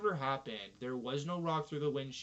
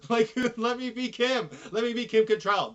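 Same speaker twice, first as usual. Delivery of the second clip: laughing; 6 semitones higher, 8 dB louder than usual. Real, not acted. Laughing fantasy outburst.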